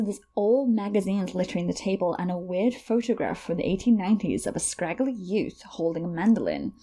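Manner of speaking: normal talking voice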